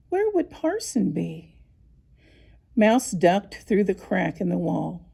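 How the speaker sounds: ordinary delivery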